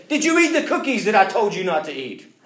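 high, loud mock interrogation; accusing parent. Parent confronts cookie-eating child, loud and accusing. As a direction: confront the culprit at full voice.

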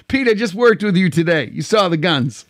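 ordinary talk, nothing marked

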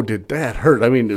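ordinary talk, nothing marked

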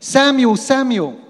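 high, loud calling voice. Acted. God calling the sleeping boy Samuel by name. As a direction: call the name across the dark.

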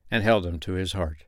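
ordinary delivery

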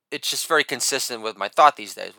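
ordinary delivery

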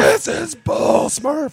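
big slow booming shout. Retold or imitated. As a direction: yell it out long and loud. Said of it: "and just goes".

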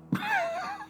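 sudden squeaky laugh; high-pitched burst of laughter. Real, not acted. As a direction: break into a high giggle. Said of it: "I couldn't say it with a straight face".

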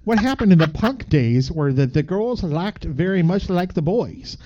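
Canadian reading aloud; Canadian accent. Acted. Reading about eighties metal as a Canadian, Canadian accent. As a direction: read it out in a folksy Canadian accent, with eh. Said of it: "read by a Canadian".

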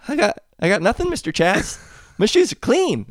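put-on high, loud protesting kids. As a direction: whine it like a child. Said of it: "all the kids look at me and they go".

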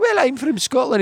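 put-on high-pitched, playful Scottish accent. Schoolboy answering his friend's dare, in a Scottish accent. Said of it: "Well, do a Scottish accent".

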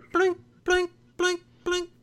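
high chiming video game coin noise; coin sound imitation. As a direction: chime it like the Mario coin. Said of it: "the coin noise from Mario".

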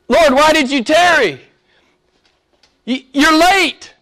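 mock reproachful outcry; acted complaint. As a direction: complain up to God, high and emphatic. Voices Martha's complaint to God.

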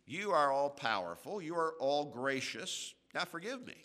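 mocking a flippant sinner's prayer; mock presumptuous prayer. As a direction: toss off a smug, entitled prayer. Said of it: "turn around and say".

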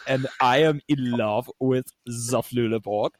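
playful, hammed-up German accent; teasing German accent, words stretched out; sexy German accent. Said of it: "you did a sexy German accent".

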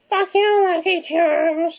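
very high, drawn-out parody cry; hammed-up high-pitched one-liner, stretching the last words; mock catchphrase. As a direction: squeal the movie line in mockery. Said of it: "it didn't feel earned".

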